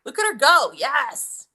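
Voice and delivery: loud, high cheering on her daughter; root for her like a ringside fan; excited cheering. Real, not acted. Mom cheering her daughter pinning her opponent, loud and high.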